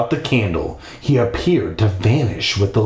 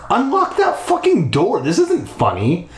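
high-pitched outraged order; angry demand; 9 semitones higher than usual. Acted. Girl ordering captor to open the door, angry demand. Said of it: "she demanded angrily".

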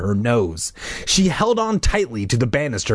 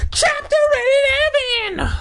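chapter announcement; faster than usual. Acted. Reader announcing the next chapter, theatrical high cry.

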